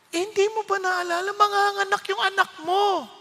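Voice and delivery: lightly voiced wife, matter-of-fact; mimicking wife. Voicing wife's objection.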